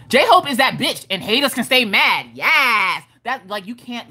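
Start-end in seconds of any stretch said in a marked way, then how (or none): 0.1-3.0 higher, emphatic name announcement; hype shout-out. Shown as a demonstration. Streamer hyping a viewer's name, sassy and emphatic. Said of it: "that way".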